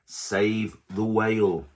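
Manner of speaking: usual speaking voice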